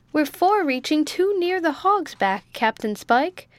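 high-pitched warning call, only mildly roared. A narrator's reading. Boatswain warns the captain from forward, a mild roar. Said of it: "roared the boatswain from forward".

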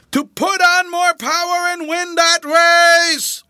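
high, slow, drawn-out yell; loudest shout. A narrator's reading. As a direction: grandfather bellowing to urge on his grandson. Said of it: "shouting in his loudest tones to his grandson".

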